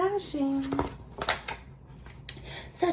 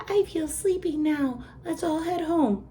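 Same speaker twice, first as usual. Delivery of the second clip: higher, sleepy cow voice; 8 semitones higher than usual. A narrator's reading. The cow wants to go home, higher sleepy voice. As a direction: say it ready for bed. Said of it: "said the cow".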